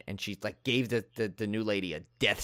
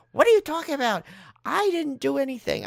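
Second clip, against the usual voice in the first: indignant retort; 14 semitones higher than usual. Retold or imitated. Accused woman fires back.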